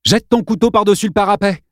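put-on boy orders the bully to drop the knife. A narrator's reading. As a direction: command it. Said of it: "ordonna Arlène".

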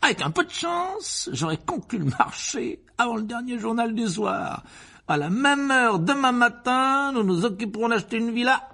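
high, swelling, exultant uncle; pompous Uncle Vernon scheming. A narrator's reading. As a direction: build to a triumphant laugh. (oncle pompeux, de plus en plus exalté)

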